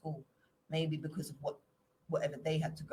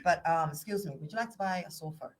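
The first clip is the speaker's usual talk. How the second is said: a light Irish lilt, playful sales pitch; mock door-to-door seller in an Irish accent; 3 semitones higher than usual. Shown as a demonstration. Friend showing off accents at a table, playful Irish lilt. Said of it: "You Irish?".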